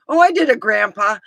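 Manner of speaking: put-on high, loud proud kid voice; proud son. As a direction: crow it like a proud boy.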